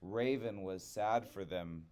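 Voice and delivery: usual speaking voice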